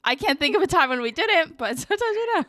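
high, through laughter; laughing that sometimes they skip therapy; laughing admission. Real, not acted. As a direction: giggle out the confession.

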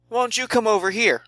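high-pitched, loose taunt; lilting high invitation; taunting call. Acted. Player calls out to the voice.

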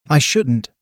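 ordinary delivery, nothing marked

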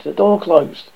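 usual speaking voice

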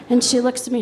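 usual speaking voice

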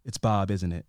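quiet dawning suspicion; grave Mitch. Retold or imitated. Mitch in the car confronting Gail, low and quiet.

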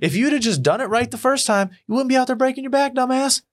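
high, quick told-you-so; smug scolding. Retold or imitated. At the window, high smug scolding of the neighbor. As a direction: rub it in from the window. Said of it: "And I'm like".

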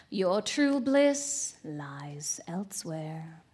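slow, hushed enticement; seductive luring. Acted. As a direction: lure him with lingering words. Mystery woman lures him.

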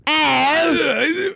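mock Cockney yelp. Shown as a demonstration. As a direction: stretch the vowel in cartoon Cockney. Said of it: "Do you have, like, a Cockney".

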